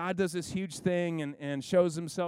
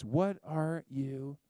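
very slow, much lower, hushed divine question; God slowly asks Elijah once more; still small voice; 3 semitones lower, much slower than usual. Acted. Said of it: "God asked him the question twice".

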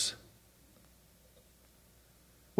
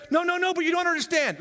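high protest; 11 semitones higher, faster than usual. Acted. Voicing believer's protest.

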